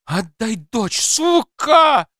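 builds to a high, loud scream; furious shout. A narrator's reading. Mother demanding her daughter back, furious shout. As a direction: scream the last word hardest. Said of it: "закричала Света".

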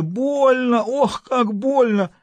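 high, slow sobbing cry; sobbing wail. A narrator's reading. Governor in pain, high slow sobbing wail. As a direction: cry out through sobs. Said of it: "вопль, перешедший в рыдание".

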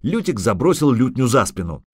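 plain reading voice

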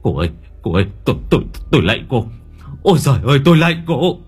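stammering, then rising to a high loud beg; panicked stuttering plea; terrified pleading. Acted. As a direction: beg the ghost for mercy, tripping over words. Terrified man begs the ghost.

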